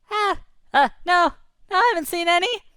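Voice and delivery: high, flustered little voice; nervous tiny fish. Acted. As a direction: squeak out a fib. Disguised anchovies answer nervously.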